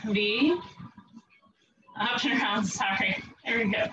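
ordinary delivery

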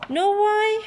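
high, loud taunting ask; teasing question. Acted. Seed baits the listener, high and loud. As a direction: bait the listener before the reveal.

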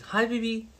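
put-on high baby-talk hello; sudden sweet greeting. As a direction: switch instantly to cutesy sweetness. Greets partner mid-interrogation.

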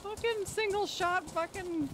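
high, agitated yelp; frustrated outburst. Real, not acted. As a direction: blurt it out in a panic. Frustrated mid-firefight.

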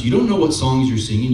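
ordinary delivery

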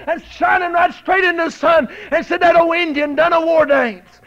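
high, loud shouted preaching; preaching at full force. Real, not acted. Preacher telling the eagle story to the church, roaring.

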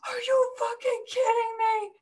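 very high, crying outrage; retold tearful fury. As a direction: wail it in mock hysterical anger. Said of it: "I was so mad".

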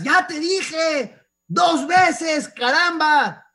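lento y gritado, harto de repetir; regaño de padre. Retold or imitated. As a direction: imita al padre que pierde la paciencia. (grito lento de padre harto)

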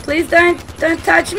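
high, loud panicked plea; scared pleading. Real, not acted. Player begs game creatures to stay back, scared pleading. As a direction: beg them off in a fright.

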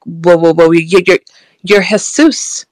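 imitating a stammer on repeated starts; stuttering. Woman recognising the man before her, stuttering. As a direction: stumble over the first words, then get it out. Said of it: "I'm stuttering and I'm like".

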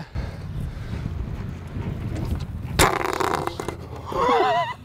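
high breathy giggling; excited giggle. Real, not acted. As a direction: giggle with delight. Laughing after a crash.

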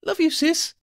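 very high, affectionate call; high-pitched sisterly call; high-voiced friend. A narrator's reading. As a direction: toss it out in a high voice. Friend's affection amid the chase.

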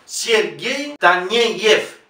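Sergei Taneyev very slowly, syllable by syllable; careful, stretched demonstration of Sergei Taneyev. Shown as a demonstration. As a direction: draw out each "ye" in Sergei and Taneyev. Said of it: "ye se pronuncia siempre ye".

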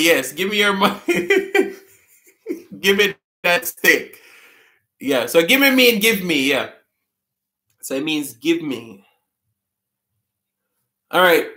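ordinary delivery

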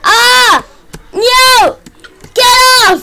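high, loud cries of alarm; panicked shouting. Real, not acted. Player attacked by a baby zombie, shouting. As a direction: fight it off with short shouts. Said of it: "sorry if I'm shouting major bad".

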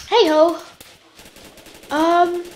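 ordinary delivery

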